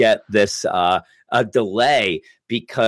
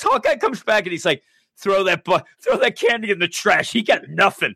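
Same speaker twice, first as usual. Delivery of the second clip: lightly voiced excited Hawkeye; 9 semitones higher than usual. Voicing Hawkeye's dismissive order.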